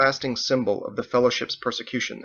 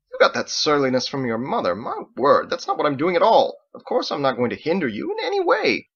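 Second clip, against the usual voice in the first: louder, higher indignant protest; indignant father; 5 semitones higher, 5 dB louder than usual. Acted. Father scolding his surly son, louder and indignant. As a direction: wag the finger and protest.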